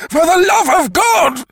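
final screaming plea from the flames; final shriek. Acted. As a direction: push the last plea past breaking. Last cry in the flames.

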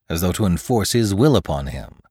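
ordinary delivery

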